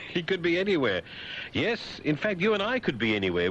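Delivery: high, derisive echo; mocking repeat. Host mocks the FBI headline, high derisive voice. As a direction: parrot it up high in ridicule.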